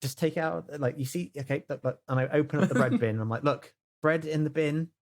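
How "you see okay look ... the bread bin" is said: ordinary talk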